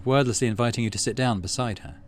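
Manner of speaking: ordinary talk, nothing marked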